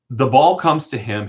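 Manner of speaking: ordinary delivery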